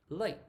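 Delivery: late said as lei, the t dropped; late without t. Shown as a demonstration. Teacher showing late without the t. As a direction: swallow the t at the end of late. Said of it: "you can also do the same".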